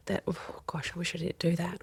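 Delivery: soft whispered regret; whispered self-reproach after a mistake; regretful whisper. Acted. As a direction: sigh it out to yourself. Voicing regretful self-talk.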